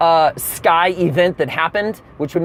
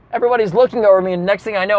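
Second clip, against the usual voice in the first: ordinary delivery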